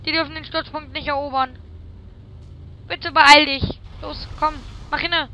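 loud, high urgent pleading. Real, not acted. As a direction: urge them on desperately. Urging teammate loudly.

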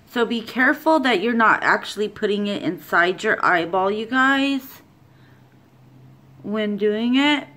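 face covered by wet mask; talking through a sheet mask. Real, not acted. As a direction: lips framed by mask opening. Seen 0: Wet sheet mask covers face; mouth hole open, lips partly restricted.

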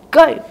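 big exaggerated gulp; loud gulp. Shown as a demonstration. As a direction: gulp it down loudly. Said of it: "she swallowed a cat like this".

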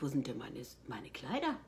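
quiet thought. Retold or imitated. She recalls silently wondering where her dresses went. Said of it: "dachte ich".

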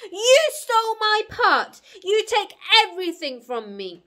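high, loud, furious shout; crying out in accusation. Acted. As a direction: hurl the accusation at full volume. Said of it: "cried Alvis".

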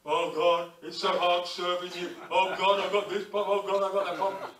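loud whining complaint to God; moaning misery. Retold or imitated. Preacher mocking a miserable believer, a loud whining moan. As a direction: moan like a self-pitying grumbler. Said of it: "God doesn't like miseries".

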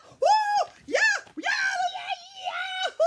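high, loud whooping; overjoyed cheering. Acted. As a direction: cheer like it's a huge triumph. Celebrating George's bark.

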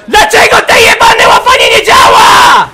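high, loud, drawn-out scream; enraged scream. Real, not acted. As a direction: scream slowly in sheer frustration. Rages that the grab fails.

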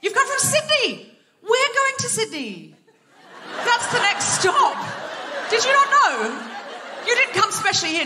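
high, incredulous teasing of the audience; squeaky disbelief at the crowd; mock alarm. Real, not acted. Startled at Sydney audience member.

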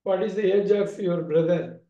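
ordinary talk, nothing marked

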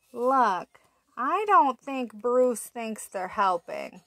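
usual speaking voice

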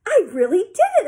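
high, loud disbelieving delight; amazed. A narrator's reading. Sheila asking over and over, amazed. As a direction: marvel at your own success. Girl amazed at her success.

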